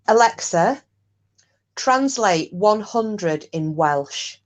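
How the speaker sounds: deliberate, slowed request to a device; slow voice command. Real, not acted. Asking Alexa so the stream can hear, slowly and distinctly. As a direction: address the smart speaker slowly and distinctly.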